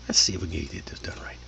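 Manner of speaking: hushed, quick whisper; muttering to self. Real, not acted. Host to himself while setting up, whispered. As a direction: whisper it hurriedly under your breath.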